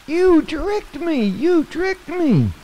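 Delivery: very high, slow, frightened cry of betrayal; soaked mouse accusing the frog, very high and frightened; scared, accusing mouse. Acted. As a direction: shriek it like a tiny wet mouse who feels fooled. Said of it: "he was scared".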